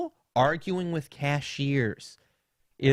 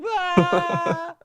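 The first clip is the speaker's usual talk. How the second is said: high-pitched mock scream; loud, high hammed-up wail; falling scream; 5 dB louder than usual. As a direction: imitate grandpa's cry as he falls. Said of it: "That's him falling".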